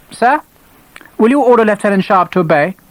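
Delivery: high, clipped officious officer; stiff, self-important lieutenant; stern young officer. Acted. Gibbons appealing.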